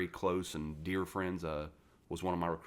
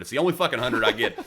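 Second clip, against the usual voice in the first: laughing, much louder, bursting through the words; 5 semitones higher, 12 dB louder than usual. Real, not acted. Telling a friend about the one perfect test, laughing. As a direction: let the laugh break in as you brag.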